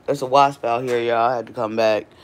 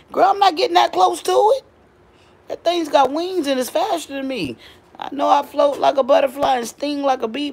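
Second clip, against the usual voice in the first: excited panic; 11 semitones higher, 5 dB louder than usual. Real, not acted. Panicky about the wasp.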